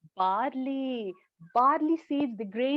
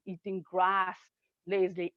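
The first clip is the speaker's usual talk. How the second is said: plain reading voice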